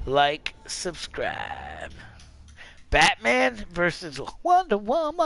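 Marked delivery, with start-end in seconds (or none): 2.9-5.3 high, hyped fight announcement; match announcer. Pretend. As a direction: call the match, stretching the last name out high.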